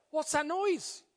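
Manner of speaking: high, startled question; acted worried caller; concerned boss. A narrator's reading. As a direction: pitch jumping up in puzzled concern. Boss growing concerned at noise.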